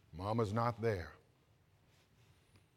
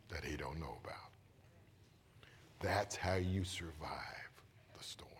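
hushed closing; 6 semitones lower, 11 dB quieter than usual. Acted. Preacher closing to the church, soft whisper. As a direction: lean in and whisper the point.

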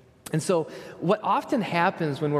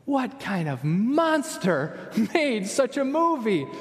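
high, slow mock indignation with a laugh; mock outrage; 6 semitones higher, slower than usual. Preacher mocking the moviemakers, slow, high and half-laughing. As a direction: play up the horror for laughs. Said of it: "and I think".

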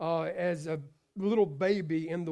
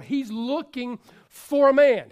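higher, louder, slow, leaning on man; slow emphatic preaching; 4 semitones higher, 8 dB louder, slower than usual. Acted. Preacher to the men, slow and emphatic. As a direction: stretch out the word man.

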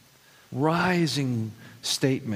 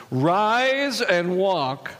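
very slow, loud, higher command; full confidence; 6 semitones higher, 5 dB louder, much slower than usual. Retold or imitated. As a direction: Peter commands the crippled beggar, loud and slow. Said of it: "with full confidence says".

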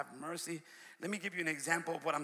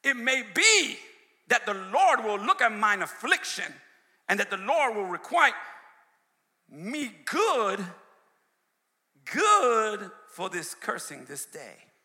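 louder, declaiming King James verse; scripture reading; 2 semitones higher, 6 dB louder, slower than usual. Acted. Preacher declaiming scripture to the church.

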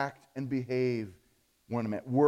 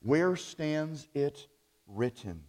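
slow, measured repeat; slower than usual. Retold or imitated. Preacher repeating the catchphrase slowly for the congregation. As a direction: let each word land slowly. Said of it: "was their phrase".

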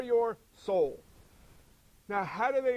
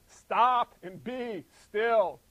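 loud, drawn-out command; slow, forceful order with weight on each word; 7 dB louder, much slower than usual. Acted. Preacher voicing God's order to the storm, slow loud command.

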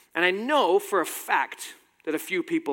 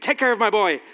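louder, higher imitation of a furious mum; mock-order a doctor like a protective mother; retold fierce demand; 5 semitones higher, 6 dB louder than usual.

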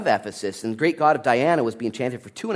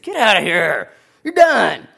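loud, raised mock anger; angry dismissal; 6 semitones higher, 7 dB louder than usual. Angry synagogue man kicking Paul out, loud mock fury. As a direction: throw him out with a loud yell. Sosthenes mad, throws Paul out.

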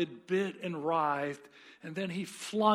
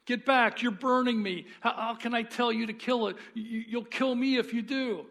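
higher, louder, pleading cries of pain; panicked ghost; 5 semitones higher, 4 dB louder than usual. Acted. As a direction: shrink back from the burning angel. Ghost burned by angel.

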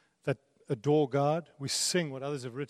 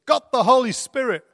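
loud cheeky excuse; 10 semitones higher, 11 dB louder than usual. Retold or imitated. Heist guy caught celebrating in airport.

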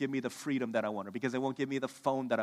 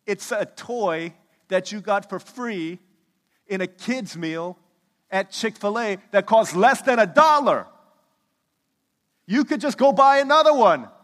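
exasperated wife; 7 semitones higher, 13 dB louder than usual. Retold or imitated. Wife scolds husband over toy.

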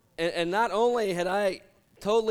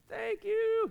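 much higher, thin, weak thanks; tiny high thanks. Retold or imitated. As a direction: squeak it out half-frozen. Freezing woman thanks rescuers.

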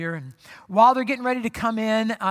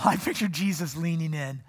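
ordinary delivery

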